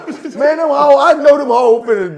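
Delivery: loud, high, talking through laughter; real laughter breaking into the words; laughing hard. Real, not acted. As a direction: explain it while cracking up. Laughing explanation after punchline.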